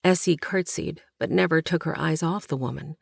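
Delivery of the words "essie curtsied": ordinary delivery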